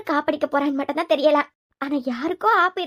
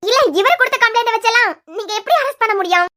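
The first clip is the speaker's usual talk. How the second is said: higher and louder, demanding an answer; raised, outraged objection to the arrest; indignant challenge; 4 semitones higher, 4 dB louder than usual. Acted. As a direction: throw the question back at him hard.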